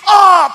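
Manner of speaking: very loud, high "oh!"; as if slapped. Retold or imitated. Imitating a listener slapped by the preaching, loud outcry. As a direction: cry out like taking a blow. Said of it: "Para ka sinasampal".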